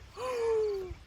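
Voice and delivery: high, pretend frightened gasp; scared gasp. Shown as a demonstration. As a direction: gasp as if suddenly spooked. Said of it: "Jacko scared".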